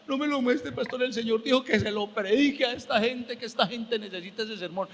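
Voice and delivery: high tearful imitation, gradually easing; imitated crying. Weeping sister relays the Lord's order to pastor, pleading. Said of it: "Ella lloraba".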